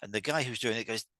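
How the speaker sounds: ordinary talk, nothing marked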